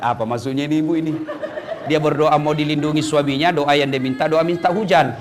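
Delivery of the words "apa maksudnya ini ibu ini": puzzled angel. Retold or imitated. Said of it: "Kata malaikat".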